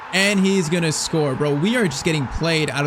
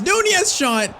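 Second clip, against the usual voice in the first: leaping high, loud cry as the shot goes off; excited shout; 13 semitones higher, 3 dB louder, much slower than usual. Real, not acted. Gamer calling his striker's shot, excited shout. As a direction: shout it with hope as he shoots. Big chance in live play.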